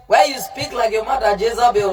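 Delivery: usual speaking voice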